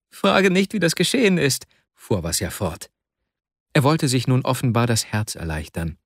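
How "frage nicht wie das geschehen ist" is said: anguished. Acted. Vasja's anguished outpouring.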